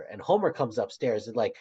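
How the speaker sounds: usual speaking voice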